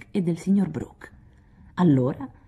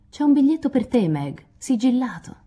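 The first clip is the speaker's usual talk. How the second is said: lively announcement; 7 semitones higher than usual. Acted. Announcing a sealed letter.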